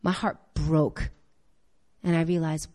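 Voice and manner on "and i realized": ordinary talk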